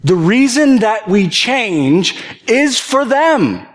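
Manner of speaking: pleading, fervent preacher; impassioned preaching. Acted. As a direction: drive the point home with passion. Preaching climax, rising intensity.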